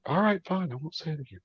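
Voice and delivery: hammed-up huffy "fine"; mock sulky giving in. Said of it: "I'm like".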